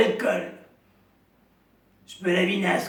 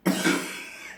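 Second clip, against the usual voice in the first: smoker's cough. Acted. Chain smoker character coughing.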